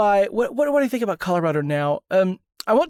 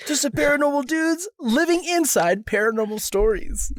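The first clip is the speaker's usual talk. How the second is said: laughing; 7 semitones higher than usual. Real, not acted. Slogan repeated, laughing.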